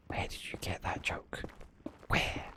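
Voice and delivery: unvoiced whispered aside. Real, not acted. Streamer whispering a quip to chat. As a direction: breathe it out as a secret.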